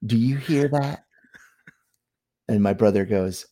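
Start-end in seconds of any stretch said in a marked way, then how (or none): 0.0-1.0 higher-pitched put-on Southern voice; Southern accent. Imitating his brother's wife questioning her husband, Southern accent. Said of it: "I'm gonna try to do my Southern accent".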